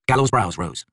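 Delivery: plain reading voice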